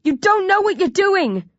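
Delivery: high, urgent cry; yelling. A narrator's reading. Hero to attacking zombies, yelling in protest. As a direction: yell desperately to stop them. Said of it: "I yell".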